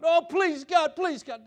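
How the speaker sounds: loud, high-pitched mock pleading; begging. Believer coming to God like a slave, exaggerated begging. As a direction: plead desperately, hammed-up. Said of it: "We come begging God".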